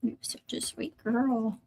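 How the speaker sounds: soft slow whisper to the dog; tender whisper to a pet. Real, not acted. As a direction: murmur it close, like a secret.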